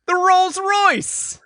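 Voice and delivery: loud, high, drawn-out announcement; grand reveal. Real, not acted. Host revealing a long-unseen car to co-host, grand reveal. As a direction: stretch it out like a big fanfare. Announcing a returning item with fanfare.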